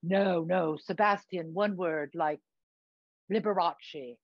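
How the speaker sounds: affected British show-business friend; camp, affected British accent; put-on British accent. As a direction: advise grandly in theatrical British. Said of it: "what's with the accent?".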